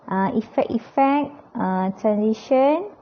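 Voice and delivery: ordinary talk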